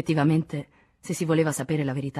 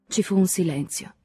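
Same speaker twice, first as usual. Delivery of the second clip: usual speaking voice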